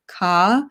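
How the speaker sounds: "ka" with the final R dropped; non-rhotic British pronunciation. Shown as a demonstration. Presenter demonstrating British English for viewers, "ka" without the R. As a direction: say "ka" as an open British vowel, no R. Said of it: "you don't pronounce the R sound".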